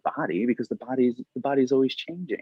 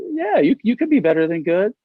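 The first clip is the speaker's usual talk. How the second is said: upbeat encouragement; 10 semitones higher, 5 dB louder than usual. Retold or imitated. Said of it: "I go".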